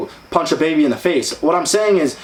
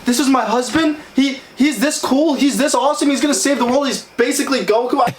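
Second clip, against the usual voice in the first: much higher, gushing girly mimicry; mocking girlfriend voice; 9 semitones higher than usual. Mocking the date who brags about her husband, high girly voice. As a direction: play the swooning date, high and sweet. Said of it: "She'll be like".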